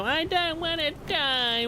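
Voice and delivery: high, slow whiny mock. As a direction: whine like a scared baby. Mocking whiny tank players.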